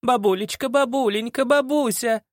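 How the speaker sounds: high, climbing gush. Acted. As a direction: pile on sugary pet names. Girl gushing over grandma.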